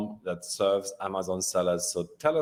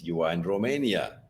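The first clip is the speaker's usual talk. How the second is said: normal talking voice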